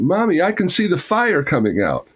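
put-on high, excited child's exclamation; full of excitement. A narrator's reading. Young son to his mother about the gunfire, full of excitement. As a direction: voice a thrilled boy, pitch well up. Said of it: "He was full of excitement".

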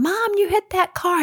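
put-on a high, urgent child's cry; retold kid scream. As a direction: imitate a kid yelling an alarm. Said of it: "Brian's screaming".

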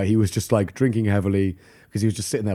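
usual speaking voice

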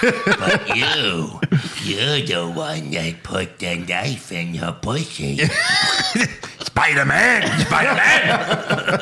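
laughing, slow dramatic cry; hammed-up dying villain; overacted death scene.

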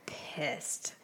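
near-voiceless whisper; hushed admission. Real, not acted. Wife admits how she felt, hushed whisper. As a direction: drop right down and whisper it. Names her anger after long pause.

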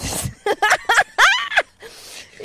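loud high-pitched laugh; high laugh burst. Real, not acted.

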